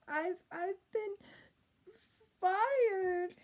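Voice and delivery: high, slow, breaking into a cry; sobbing. A narrator's reading. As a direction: sob out the bad news. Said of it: "sobbed the ordinary princess".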